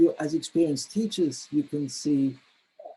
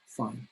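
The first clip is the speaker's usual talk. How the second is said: "fine" in one stressed, falling syllable; falling intonation on fine; 2 semitones lower, 9 dB quieter than usual. Shown as a demonstration. Teacher showing learners how to answer, falling tone on "fine". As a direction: say "fine" with the pitch going down. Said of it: "the intonation is, is down".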